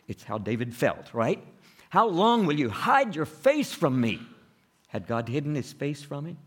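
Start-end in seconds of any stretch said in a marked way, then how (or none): 1.9-4.2 louder, higher pleading question; pleading lament. Acted. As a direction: ask God with hurt urgency. Voicing David's psalm.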